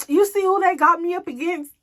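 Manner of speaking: high, indignant friend's voice. Retold or imitated. Retelling Pearl complaining on the phone, high and indignant. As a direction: play her upset about the Facebook versus. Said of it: "She's like".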